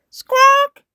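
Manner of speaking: very high, loud bird cry; parrot squawk. A narrator's reading. Polly answers in the shop, high parrot squawk. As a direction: let out a sharp parrot squawk. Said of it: "Polly Parrot opened her beak".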